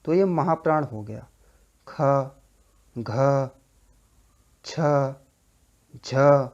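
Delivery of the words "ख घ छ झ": ख, घ, छ, झ each said alone with a strong puff of breath, like a trailing ha; aspirated consonants demo. Shown as a demonstration. Teacher showing students the breathy ha in aspirated consonants. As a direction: push out an audible ha after ख, घ, छ, झ. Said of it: "हा जैसी sound आ रही है".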